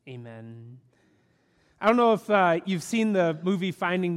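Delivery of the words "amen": hushed, reverent opening prayer. Acted. Opening prayer before sermon.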